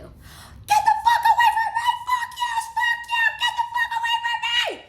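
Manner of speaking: piercing high scream of terror at the ex; shrieking at the ex to get away; screaming bloody murder. Shown as a demonstration. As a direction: scream it like you're about to be kidnapped. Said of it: "I can demonstrate it for you".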